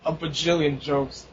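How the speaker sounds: ordinary talk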